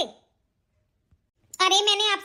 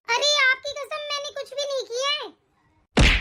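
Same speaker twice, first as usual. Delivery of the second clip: higher, tearful-sounding plea; pleading; 5 semitones higher, slower than usual. Acted. Wife begging her husband to believe she didn't burn it, pleading. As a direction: beg him to believe you.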